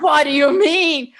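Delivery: slow, loud, indignant retort; pissed off. Retold or imitated. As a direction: throw the question back, outraged and drawn out.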